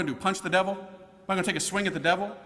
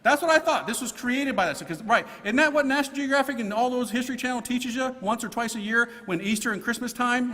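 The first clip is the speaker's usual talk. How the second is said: normal talking voice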